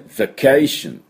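vacation slow and high, buzzed V, long CAY; model English pronunciation. Shown as a demonstration. Teacher presenting the completed word, slow model pronunciation. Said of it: "この音です".

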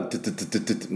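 rapid machine-gun tu tu tu; staccato drill-like tu syllables; short strong tu bursts. Shown as a demonstration. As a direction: fire off short, hard tu sounds like a drill. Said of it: "短くて強く".

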